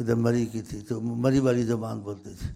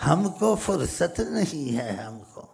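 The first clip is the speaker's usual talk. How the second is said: higher, slow mimicry of an Indian accent; 3 semitones higher, slower than usual. Speaker showing listeners how Indians talk, imitated Indian accent. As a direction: stretch it out in a lilting mock Indian accent. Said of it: "ہندوستانی ایسے بولتے".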